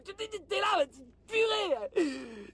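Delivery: high, strained mock battle yell; hammed-up high-pitched alarm; mock war mode. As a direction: act out a nation in panic. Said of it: "qui est en mode, euh, en mode guerre".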